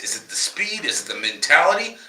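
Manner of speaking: ordinary talk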